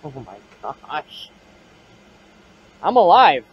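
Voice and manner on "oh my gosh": hushed, slow, spooked gasp; nervous night vlog whisper. Real, not acted. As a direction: breathe it out, unnerved. Reacting to spirit saying dead.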